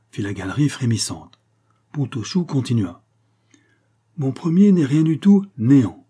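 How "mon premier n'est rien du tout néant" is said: louder, higher proud declaiming; proud announcement. A narrator's reading. Pontauchoux proudly answers the riddle, loud declaiming. Pontauchoux solves charade before the crowd.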